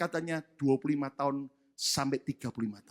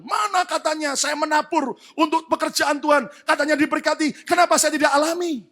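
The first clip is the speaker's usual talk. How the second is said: mock complainer; 9 semitones higher, 6 dB louder than usual. Complainer voiced.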